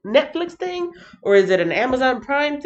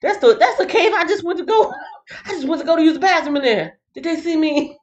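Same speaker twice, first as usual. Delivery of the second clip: laughing; 8 semitones higher than usual. Retold or imitated. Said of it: "Saul was probably like".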